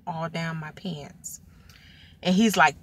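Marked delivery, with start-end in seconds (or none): 0.1-1.4 whispered, slowing down; sheepish hushed confession; embarrassed whisper. Real, not acted. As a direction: drop to a hush for the embarrassing part.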